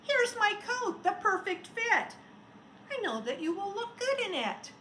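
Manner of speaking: put-on high, bright child's voice; little girl handing the snowman her coat; little girl's offer.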